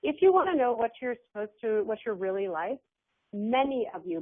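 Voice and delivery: ordinary talk, nothing marked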